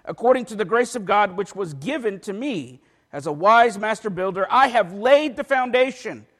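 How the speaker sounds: emphatic scripture reading. Acted. Preacher reading Paul's letter to church, loud emphatic proclamation. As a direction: proclaim the verses, punching key words.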